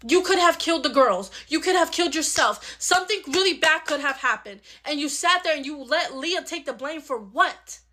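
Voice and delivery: normal talking voice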